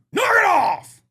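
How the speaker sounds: sudden bellow from the bar; angry shout. Retold or imitated. Bar owner yelling at the comic onstage, angry shout. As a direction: yell it to shut him up. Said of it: "It just goes".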